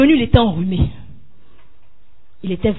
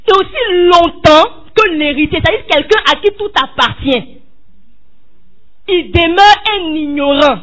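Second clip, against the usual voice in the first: slow, loud preacher's cry; fervent sermon shout; preaching cry; 2 semitones higher, 3 dB louder, slower than usual. Acted. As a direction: cry it out to the congregation.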